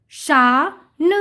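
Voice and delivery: शा drawn out, then a separate न; sounding out. Shown as a demonstration. Teacher reading to children, sound by sound. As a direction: split it slowly: शा... न. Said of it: "उच्चारण करके पढ़ लिया".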